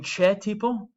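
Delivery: "che" said as English "chair", with a CH; wrong CH sound. Shown as a demonstration. Teacher showing students the wrong way, English CH. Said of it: "Notice I'm not saying".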